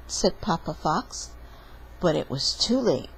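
usual speaking voice

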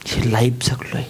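breathy whisper of a hard life. Real, not acted. Speaker telling his audience of his hard life, whispered. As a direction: let it fall to a bare whisper.

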